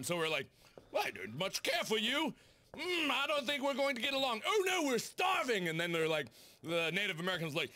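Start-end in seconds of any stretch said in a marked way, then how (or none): 0.9-5.7 mock British settler, rising high; British accent. British settler snubbing Native Americans, mock British accent. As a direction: put on a fussy high-pitched British voice. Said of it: "we were British at this time".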